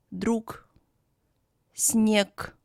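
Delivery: "друг, снег" ending in a hard k; final G as K. Shown as a demonstration. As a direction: model друг as drook and снег as snyek. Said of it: "is pronounced as K".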